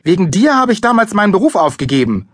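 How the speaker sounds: high, loud, angry accusation; furious snapping. A narrator's reading. Mother lashing out at father, furious. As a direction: hurl the blame at him. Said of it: "fauchte sie Papa an".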